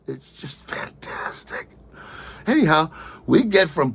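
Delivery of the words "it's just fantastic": slow, soft whispered wonder; a hushed whisper of amazement; awed whisper. Real, not acted. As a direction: breathe it out in awe. High on hallucinogens in cab.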